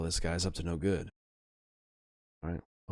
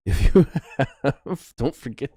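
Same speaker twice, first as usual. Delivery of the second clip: loud laugh breaking into the words; 8 semitones higher, 12 dB louder, slower than usual. Real, not acted. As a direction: crack up mid-sentence, real amusement. Laughs at own near mishap.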